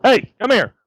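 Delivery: high, loud call; calling the dog. Real, not acted. Owner calling his dog back to his side, loud call. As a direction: snap the dog to attention.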